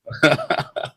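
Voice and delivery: soft, breath-only laugh; real chuckle. Real, not acted. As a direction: chuckle quietly at your own jibe. Laughs after mocking the group.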